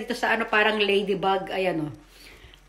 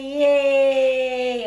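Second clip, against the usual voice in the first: cheer; 5 semitones higher, 5 dB louder than usual. Real, not acted. Cheering at ride.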